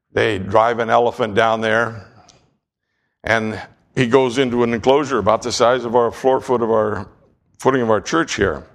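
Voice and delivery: usual speaking voice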